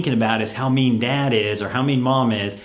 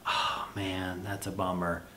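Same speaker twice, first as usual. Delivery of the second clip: much softer, lower, sympathetic; empathetic; 3 semitones lower, 9 dB quieter than usual. Shown as a demonstration. Parent answering child who forgot homework, soft sympathy. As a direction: soothe the kid gently, full of sympathy. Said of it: "You wanna show some empathy".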